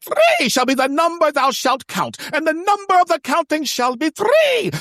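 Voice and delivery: high, loud holy-scripture proclamation; totally different voice. Retold or imitated. Contact reciting the counting rules, high loud proclamation. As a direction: declaim it like a pompous cleric at full pitch. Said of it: "in a totally different voice".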